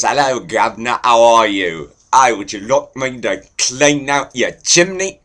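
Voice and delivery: loud, high, hammed-up Cockney chimney sweep; Cockney British accent. Shown as a demonstration. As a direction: call out cheerily in a broad Cockney accent. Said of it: "there's different types of British".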